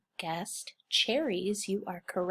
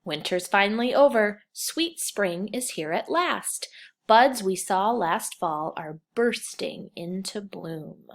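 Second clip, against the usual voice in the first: slow, louder storybook reading; reading to children; 5 dB louder than usual. Acted. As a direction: read the story out to the class. Teacher reads picture book aloud.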